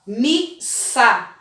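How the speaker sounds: Missa with a sharp hissing S, never a Z; teacher showing the double S sound; clear S sound. Shown as a demonstration. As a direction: model Missa with a crisp double S. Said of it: "ele tem o som de sa".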